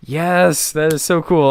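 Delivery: pumped-up fan reaction; real delight. Real, not acted. Player delighted by the discovery.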